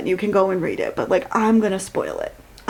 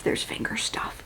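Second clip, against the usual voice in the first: whispered aside; embarrassed whisper; 11 dB quieter than usual. Real, not acted. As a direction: whisper it like a secret. Awkward aside about sex scene.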